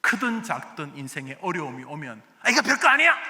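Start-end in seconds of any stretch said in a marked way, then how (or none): 2.4-3.1 higher, louder brush-off; mock bravado. Preacher mimicking a proud person, loud mock bravado. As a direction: scoff at trouble with fake toughness. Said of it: "이런지 않습니다".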